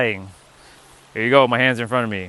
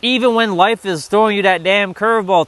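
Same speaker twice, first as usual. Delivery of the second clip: ordinary talk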